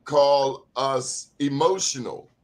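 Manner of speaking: ordinary delivery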